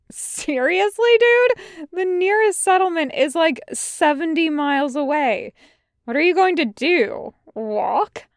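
high-pitched incredulous questioning; amused disbelief. A narrator's reading. Tucker reacts to Wash planning to leave, incredulous. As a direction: scoff at the absurd plan, pitch up. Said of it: "Tucker actually laughs".

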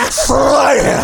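loud, high prophetic proclamation; booming shout. Pretend. Dramatic scripture for fight entrance.

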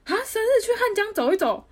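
mock shocked friend questioning a birthday walk by the river; retold disbelief. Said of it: "我想说".

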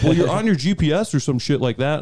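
mock angry cop. Cop accusing the driver in the retold story, mock angry.

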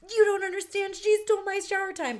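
high, loud upset wail; emotional teen protest. Retold or imitated. Teen daughter pleading her case to her father, upset and high. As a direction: protest the injustice at full pitch. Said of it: "in her mood".

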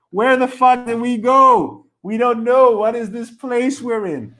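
high, drawn-out wail of bafflement; slow, loud and high incredulous outcry; exasperated existential rant. Acted. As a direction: cry it out in utter bewilderment. Rant over where we go in sleep.

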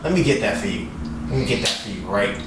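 soft, low mock-considerate tone; mock helpfulness. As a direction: quietly overdo the helpfulness, dropping low. Sarcastically doing the chore for her.

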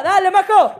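loud, high call from up the trail; nephew cheering. Retold or imitated. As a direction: cheer her on as the nephew shouting down. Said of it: "Y mi sobrino de arriba". (grito de ánimo del sobrino desde arriba)

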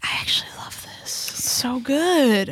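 unvoiced whisper, slow; hushed amazed whisper; whispered delight. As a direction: whisper it like a secret discovery. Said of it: "I was like".

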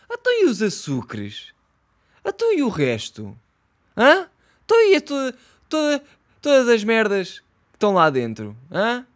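loud, high indignant questioning; outraged rant. Real, not acted. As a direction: yell the questions at him, fed up. Ranting back at the video.

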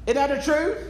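normal talking voice

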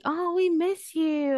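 high, slowed friendly pining; imitated cooing. Friends telling her they miss her, cooing high. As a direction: coo it up high like fond friends.

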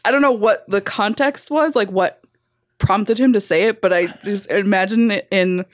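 usual speaking voice

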